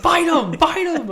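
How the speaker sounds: high, loud, laughing "Bite him, bite him"; gleeful cartoon hype, laughing through it; imitating an overexcited Luffy, laughing; excited mock Luffy with laughter. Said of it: "He was like".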